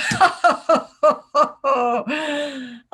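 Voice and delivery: long, loud, high mock guffaw; hammed-up laughter. Operatives celebrating over vodka, long hammed-up laughter. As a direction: roar with exaggerated stage laughter. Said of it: "go home at night laughing".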